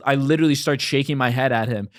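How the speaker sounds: normal talking voice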